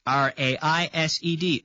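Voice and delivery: letters of R A I S E D named one by one; spelling it out. Shown as a demonstration. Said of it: "No extra syllable".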